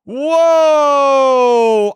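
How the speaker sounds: ordinary talk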